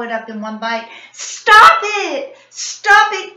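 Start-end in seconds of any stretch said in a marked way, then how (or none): 1.1-3.4 very high, loud screaming. A narrator's reading. Bully screaming at the kite to spare his pigeon, loud scream. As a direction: scream it out in panic. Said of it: "Tony screamed".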